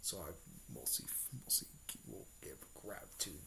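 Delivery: low, soft, ghostly whisper; Nine Tails whispering inside Sakura's mind, eerie; whispering demon fox. Retold or imitated. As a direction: breathe it like a monster in her head. Nine Tails thanks her in mind.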